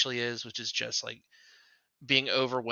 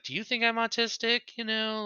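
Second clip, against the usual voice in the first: higher-pitched, mildly imitated worried question; retold freaking out; 11 semitones higher than usual. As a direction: lightly imitate someone starting to freak out. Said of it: "was kinda freaking out about it".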